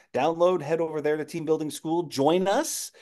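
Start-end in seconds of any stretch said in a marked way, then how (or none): none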